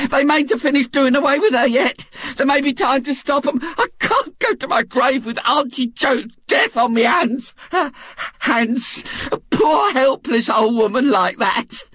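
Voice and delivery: a matron working herself into tears; frantic Mrs Bott. Acted. Mrs Bott fears for poor Auntie Jo, tearful and shouting.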